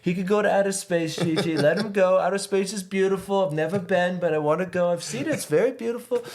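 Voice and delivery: high, slurry tipsy king; drunk Ox King. Retold or imitated. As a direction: babble like a happy drunk grandpa. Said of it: "I don't know why I'm making him, he's, like, drunk".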